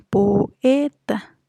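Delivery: "poeta" broken into po-e-ta; "poeta" in three separated beats, po, e, ta; divided into syllables. Shown as a demonstration. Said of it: "dividimos la palabra en".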